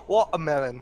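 "Watermelon" as "waw-a-melon", middle consonants gone; odd pronunciation imitated. Friend repeating someone's odd word on request, mimicking. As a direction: say "Watermelon" without its t or r. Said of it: "Can you say that again?".

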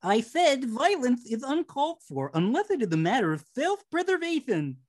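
lightly lisped prim voice; a hint of lisp on the s sounds. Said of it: "I can do the lisp".

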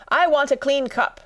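high, insistent interruption; demanding Hatter. Acted. Hatter interrupting to demand a cup, high and insistent.

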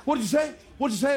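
retelling a teammate's angry challenge; Jeff's mock confrontation. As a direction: play the offended teammate, a little high. Jeff angrily confronting after punch.

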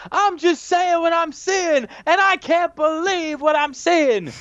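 hammed-up announcer call, high and drawn out; Bash. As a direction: imitate an astonished ringside commentator at full pitch. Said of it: "I love this call by Bash".

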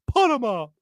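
a quick hint of the Wizard of Oz lion; Cowardly Lion voice. Host pictures Embiid as the lion in a charity Wizard of Oz. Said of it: "[name] fighting a lion doing his JoJo voice".

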